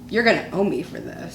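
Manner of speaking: imitating husband, quiet and low; low mock grumble. Husband warning his wife after the video, quiet imitated grumble. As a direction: grumble it under your breath. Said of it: "he looks at me and goes".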